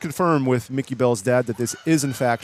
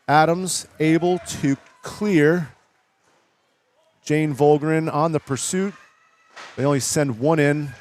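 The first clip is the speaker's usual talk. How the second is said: play-by-play commentary; 2 semitones higher than usual. Real, not acted. Live play-by-play call.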